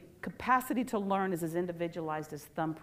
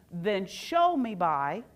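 slow, deliberate parent reply; slow, calm demo; slower than usual. Shown as a demonstration. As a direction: lay out each Then show me slowly and evenly. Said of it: "Do you hear the difference?".

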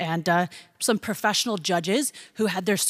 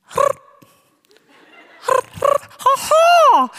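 high, loud imitated bird cry; hammed-up bird call shriek; mock bird call; 6 dB louder than usual. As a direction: squawk out the call like a contestant.

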